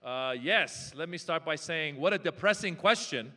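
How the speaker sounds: ordinary talk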